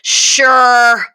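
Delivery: loud, curt reluctant yes; annoyed. Retold or imitated. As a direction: snap out a grudging sure. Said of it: "she's just like".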